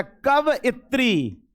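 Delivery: कवइत्री said slowly, syllable by syllable, ka-va-i-tri; teacher spells out कवइत्री carefully; correct pronunciation. Shown as a demonstration. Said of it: "pronunciation कवित्री का होता है". (कवइत्री धीरे-धीरे साफ़ उच्चारण)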